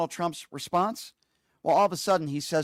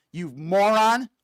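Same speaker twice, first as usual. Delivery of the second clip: insult; 3 semitones higher, 5 dB louder, much slower than usual. Real, not acted. Host insulting Trump.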